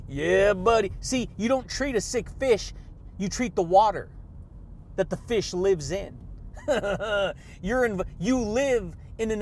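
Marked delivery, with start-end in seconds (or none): none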